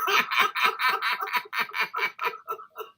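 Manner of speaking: high, airy fit of laughter at his own story; breathless laugh. Real, not acted. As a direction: collapse into helpless laughter.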